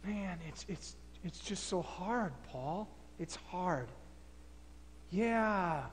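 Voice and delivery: soft, low, sighing struggle; defeated and torn. Acted. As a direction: barely get the words out. Philemon gives in, struggling.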